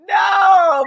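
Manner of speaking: loud, high, long no; mock-horrified parent's wail; retold cry of dismay. Parent's dismayed reaction to mess.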